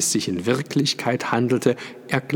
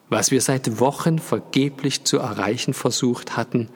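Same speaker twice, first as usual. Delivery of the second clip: usual speaking voice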